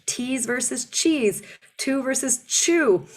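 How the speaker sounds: slow contrast of T's and cheese, two and chew; T versus CH. Shown as a demonstration. Coach contrasting T and CH sounds slowly. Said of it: "the T and the C H".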